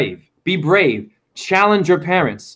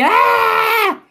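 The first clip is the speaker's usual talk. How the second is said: high-pitched mock scream of a yelling mom; imitated yell. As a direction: mimic an angry mother's shriek, pitched way up. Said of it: "My mom is yelling at me".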